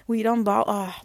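ordinary delivery

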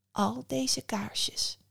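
slow, soft, whispery reveal; hushed Mother Death. A narrator's reading. Mother Death shows the boy all the candles, hushed. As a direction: let it out slowly and softly.

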